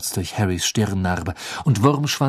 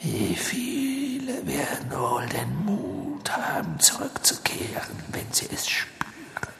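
whispering dark lord; 6 semitones higher, 7 dB quieter than usual. A narrator's reading. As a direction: gloat in a cold whisper. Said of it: "flüsterte er".

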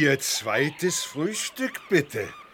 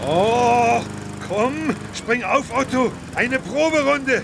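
excited; 6 semitones higher than usual. Acted. Elefant ruft Otto begeistert.

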